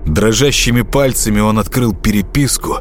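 plain reading voice

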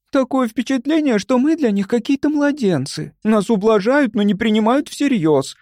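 high-pitched indignant complaint. A narrator's reading. Young woman objecting to being treated like babies, indignant. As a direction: protest in a high, wounded voice. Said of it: "возмущалась Любочка".

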